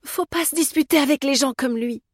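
high-pitched anxious plea; worried, pleading warning; imploring. A narrator's reading. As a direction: plead with him to avoid a fight. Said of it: "l'implora-t-elle".

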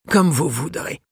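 vieux libraire, aigu et aimable; céder avec amabilité; ton avenant. Acted. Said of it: "d'un ton avenant". (le libraire cède à Mathias, d'un ton avenant)

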